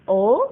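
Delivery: O swept sharply upward; startled-sounding O, pitch shooting up; rising tone. Shown as a demonstration. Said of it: "yang O sama ya".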